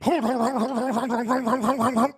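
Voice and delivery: loud buzzing haaaa engine drone; a high, loud prop plane drone; prop plane noise. Shown as a demonstration. Streamer imitating a prop plane, loud droning engine noise. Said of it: "Here's a prop plane".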